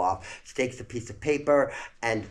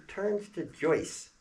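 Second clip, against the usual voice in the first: ordinary delivery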